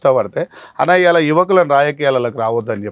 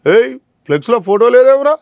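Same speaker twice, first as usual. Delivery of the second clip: bossy leader yelling; 8 semitones higher, 4 dB louder than usual. Retold or imitated. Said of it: "ఒకడు phone చేస్తాడు".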